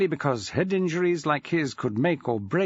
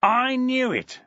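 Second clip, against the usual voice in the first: thundering; 9 semitones higher, slower than usual. Acted. Felton to Maxwell on the phone, thundering. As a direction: boom it out in triumph. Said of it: "Felton thundered".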